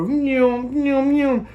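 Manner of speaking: high squawking bird cries; high mock bird screeches; imitating angry bird calls. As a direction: screech like attacking robins. Robins diving and screeching.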